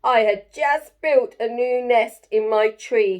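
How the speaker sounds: loud, indignant bird's complaint; shrieking bird. Acted. Bird scolds Jim for felling its tree, loud shriek. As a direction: squawk it out at the woodcutter. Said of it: "shrieked the bird".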